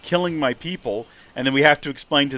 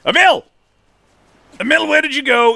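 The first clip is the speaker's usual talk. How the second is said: loud high call for a lost friend; 11 semitones higher, 8 dB louder than usual. Real, not acted. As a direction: shout his name across the area. Calling out for lost companion.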